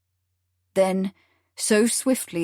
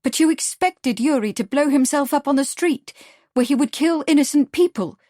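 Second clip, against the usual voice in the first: higher-pitched, incredulous protest; 6 semitones higher, 4 dB louder than usual. A narrator's reading. Lottie challenging Tropov over the bombing, incredulous. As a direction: push the pitch up in disbelief. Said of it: "Lottie sounded incredulous".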